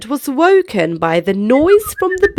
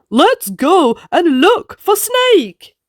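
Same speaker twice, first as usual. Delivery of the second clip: eager tiger; 5 semitones higher than usual. Acted. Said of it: "says Tiger".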